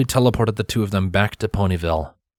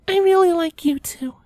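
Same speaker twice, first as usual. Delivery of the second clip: Twilight's shy confession. A narrator's reading. Twilight confessing her feelings to Silver, high and shy. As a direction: confess it shyly but sure. Twilight's words replayed in memory.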